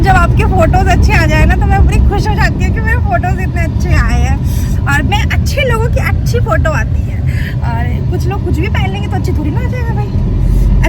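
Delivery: self-praise. Real, not acted. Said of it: "मैं अपनी तारीफ खुद ही कर लेती हूँ".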